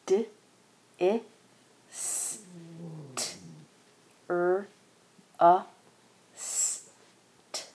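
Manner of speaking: each sound of "distrust" apart with long pauses; slow segmenting distrust. Shown as a demonstration. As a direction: spell out the sounds one by one. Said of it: "Five sounds".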